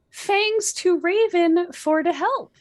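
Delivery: high, loud chirpy thanks; an over-excited fangirl voice; mock perky author. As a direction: read it like a bubbly teen fan. Reading author's note chirpily.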